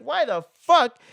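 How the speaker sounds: high, indignant acted outburst; pissed-off Seth. Seth demanding answers from Carlos at dawn, pissed off. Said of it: "Seth is pissed".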